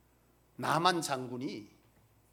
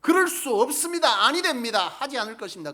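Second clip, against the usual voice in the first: Peter's protest; 6 semitones higher, 11 dB louder than usual. Retold or imitated.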